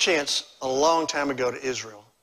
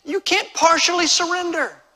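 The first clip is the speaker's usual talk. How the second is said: high, loud pulpit delivery; emphatic preaching; 12 semitones higher, 7 dB louder than usual. Real, not acted. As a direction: drive the point home to the congregation, voice raised high. Preaches at full force.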